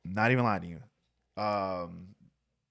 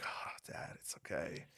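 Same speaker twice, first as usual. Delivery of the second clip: softer, unvoiced reply, like a drunk son hiding it; mock whisper; 7 semitones lower, 16 dB quieter than usual. Drunk son reassuring dad on the drive, whispered. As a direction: whisper it to dad, playing innocent. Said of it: "And I'm like".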